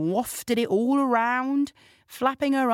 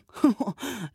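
short sneering laugh; mocking laugh; 5 semitones higher than usual. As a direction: laugh down at her. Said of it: "laughed the judge's daughter".

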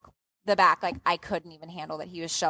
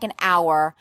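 ordinary talk, nothing marked

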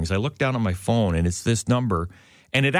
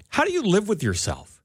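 retold reproach; 9 semitones higher than usual. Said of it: "this is what I said to her".

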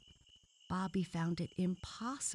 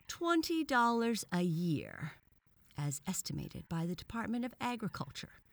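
triumphant; 6 semitones higher, 3 dB louder than usual. A narrator's reading. Frog corrects the boy's valuation, triumphantly. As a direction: proclaim the figure as a winning point. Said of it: "cried the frog triumphantly".

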